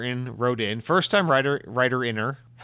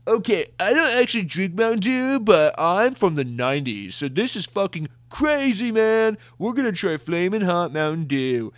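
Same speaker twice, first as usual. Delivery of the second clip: high, put-on hype-guy voice; mock an over-excited video host; exaggerated YouTuber imitation, pitched up and stretched out; clickbait YouTuber; 6 semitones higher than usual. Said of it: "clickbaity videos where someone's like".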